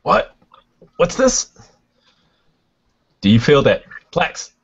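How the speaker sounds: surprised in character. Acted. Sensing a faint signal and asking Plex, surprised. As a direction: react as if catching something unexpected.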